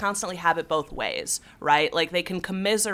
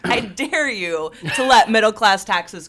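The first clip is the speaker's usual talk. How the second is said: defiant dare; 4 semitones higher, 7 dB louder than usual. Real, not acted. Host daring Congress not to let tax cuts expire, defiant and loud. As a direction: throw down the dare at them.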